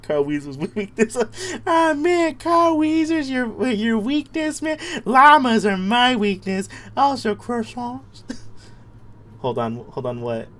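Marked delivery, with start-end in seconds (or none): 1.7-8.2 high, slow cartoon whine; squeaky put-on voice. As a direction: stretch it out like a silly cartoon. Put-on voice replies to chat.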